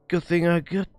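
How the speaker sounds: ordinary talk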